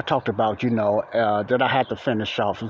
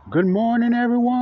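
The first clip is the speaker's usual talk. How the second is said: much higher, louder bright hello; high-pitched greeting; 11 semitones higher, 4 dB louder than usual. Shown as a demonstration. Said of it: "That's my morning voice check".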